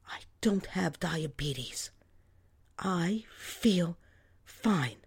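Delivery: slow, barely voiced insistence; stubborn mother whispering denial; whispered denial. A narrator's reading. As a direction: deny it under your breath.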